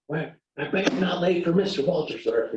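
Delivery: high, sarcastic jab about another teacher; mock yelling. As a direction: sneer it like a jealous scold. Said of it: "yelling at her".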